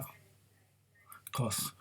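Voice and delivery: a dry whispered "of course"; whispered aside. Real, not acted. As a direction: breathe it as a wry aside to the viewer. Sarcastic aside in retold dialogue.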